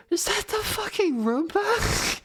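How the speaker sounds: high, drawn-out mock incredulity; incredulous retold question. Roommate hearing the robot vacuum at night, mock incredulous. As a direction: imitate a roommate's high-pitched disbelief.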